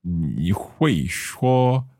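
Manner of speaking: very slow 你会说; careful learner's Mandarin. Shown as a demonstration. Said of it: "so would I say".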